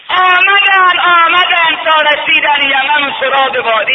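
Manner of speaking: high, loud chanted preaching; soaring rowzeh-style sermon chant; preaching at full force. Acted. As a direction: lift the journey into a ringing chant.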